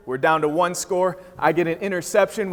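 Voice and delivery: normal talking voice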